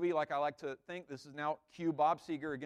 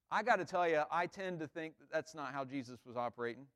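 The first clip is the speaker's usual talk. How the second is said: ordinary delivery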